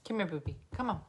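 usual speaking voice

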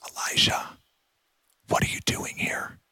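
hushed, fully whispered and gentle; barely-there intimate whisper; little whispering voice. Acted. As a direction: whisper it softly, as if to someone very close. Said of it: "in a little whispering voice".

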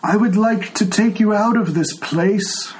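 put-on a frail old man who can't hear; old blind tiefling. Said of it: "he says".

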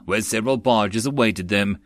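usual speaking voice

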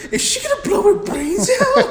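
high, squealed mock question; Alex. Teasing a friend about the movie, imitated in a high squeal. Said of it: "And then Alex was like".

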